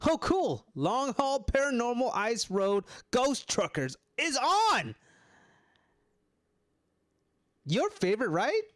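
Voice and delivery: high, loud, excited chirp; excited Amanda. Acted. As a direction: gush like a kid spotting her favourite show. Amanda excited about the show.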